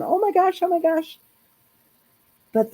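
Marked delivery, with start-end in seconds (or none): 0.0-1.2 high-pitched giddy retelling, at normal volume; retold excitement. Woman retelling her reaction to a job offer, excited. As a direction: replay your thrilled squeal in a much higher voice. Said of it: "jumping up and down and going".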